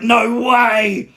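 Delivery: high, loud cry of disbelief; shocked shout. Retold or imitated. Fan seeing the hand come out, shocked shout. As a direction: blurt it out like you can't believe your eyes. Recalling The Fiend's return.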